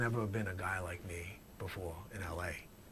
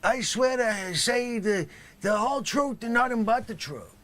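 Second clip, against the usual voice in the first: overblown oath; 11 dB louder than usual. Acted. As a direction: bellow the oath like a bad actor. Repeats oath in same way.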